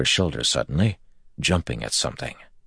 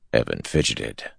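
plain reading voice

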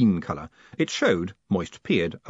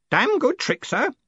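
very high, louder gleeful cackle; cackling old man; 4 dB louder, slower than usual. A narrator's reading. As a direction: cackle with delight at the trick. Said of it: "the old man cackled".

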